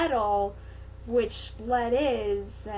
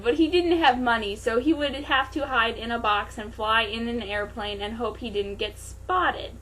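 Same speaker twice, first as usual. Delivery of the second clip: plain reading voice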